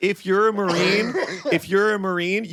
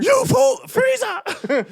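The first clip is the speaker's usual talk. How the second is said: shrill high screaming battle cry; over-the-top cartoon hero yell; anime shout; 3 dB louder, much slower than usual.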